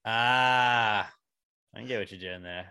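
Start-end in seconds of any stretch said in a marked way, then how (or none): none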